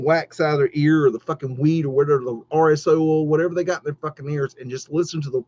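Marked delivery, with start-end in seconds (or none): none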